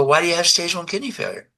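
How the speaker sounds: quick, high-pitched retold query; probing question. Store owner questioning customer about kidneys, probing question.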